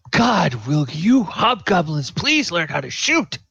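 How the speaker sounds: high-pitched, hammed-up scolding; exasperated priest. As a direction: whine in exasperation at useless underlings. Exasperated priest scolding his hobgoblins.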